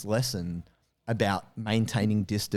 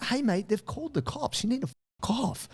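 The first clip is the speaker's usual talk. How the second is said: high, blunt get-lost order; final warning; 12 semitones higher than usual. Retold or imitated. Arborist warning the attacker the police were called, high voiced. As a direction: tell him the cops are coming. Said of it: "she said".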